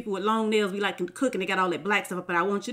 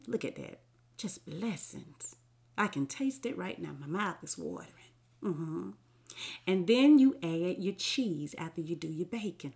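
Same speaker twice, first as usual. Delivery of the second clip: hushed; 3 semitones lower, 6 dB quieter than usual. Real, not acted. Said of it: "Why am I whispering?".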